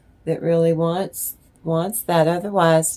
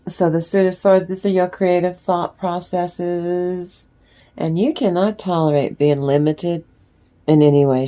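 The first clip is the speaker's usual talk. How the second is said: ordinary talk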